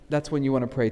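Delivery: ordinary talk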